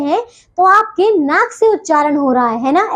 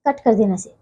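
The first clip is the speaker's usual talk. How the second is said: much lower, softer aside; off-script aside; 7 semitones lower, 6 dB quieter than usual. Real, not acted. Teacher asks editor to cut the take, low quiet aside. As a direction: drop your voice for a note to the editor.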